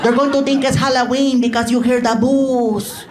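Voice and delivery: put-on Mexican mother with accented English; put-on mother voice, drawing out the boos; warning mom. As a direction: caution the son as a doubting mom. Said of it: "She goes".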